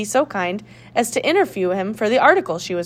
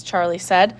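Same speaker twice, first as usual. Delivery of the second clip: plain reading voice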